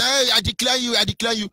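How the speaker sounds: imitating officials declaring people wanted; mock declaration. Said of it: "That is why they say".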